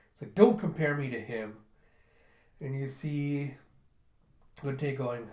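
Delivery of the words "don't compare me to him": louder, indignant snap; offended retort. Retold or imitated. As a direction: bristle at the comparison. Gunte offended by comparison.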